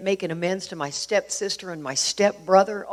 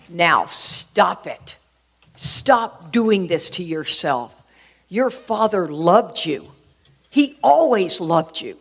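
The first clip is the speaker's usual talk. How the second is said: slow, emphatic retold scolding, building louder; aggressive stepmom; 3 dB louder, much slower than usual. Stepmom tells her crying stepdaughter to stop it, aggressive and slow. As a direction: imitate a stepmom firmly insisting, each word drawn out. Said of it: "she then got aggressive".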